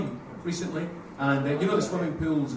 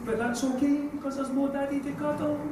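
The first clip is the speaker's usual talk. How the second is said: a hint of a little girl, higher and tender; sweet child's song; 7 semitones higher than usual. Comic imagining his daughter's sweet song, a hint of childish tenderness. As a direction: lightly play a sweet toddler's rhyme. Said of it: "I expected something along the lines of".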